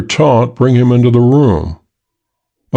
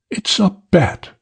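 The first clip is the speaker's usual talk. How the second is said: high, softer, sly promise; coy woman; 9 semitones higher, 5 dB quieter than usual. Acted. The girl baiting Hook, soft and high.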